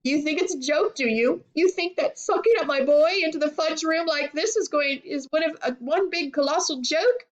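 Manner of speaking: high, outraged challenge; mildly furious mother; indignant. A narrator's reading. Furious mother confronts Wonka.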